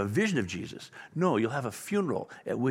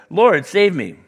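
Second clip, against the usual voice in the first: cry for help; 5 semitones higher, 8 dB louder, slower than usual. Retold or imitated. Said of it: "cried out".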